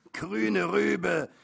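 put-on high, loud drunken exclamation; booming boozy taunt; drunken repeating. A narrator's reading. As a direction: blurt it loudly, like a drunk. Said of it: "wiederholte einer immer wieder".

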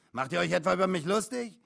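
loud, high accusing question; affronted trucker demand; suspicious challenge. A narrator's reading. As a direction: confront them indignantly.

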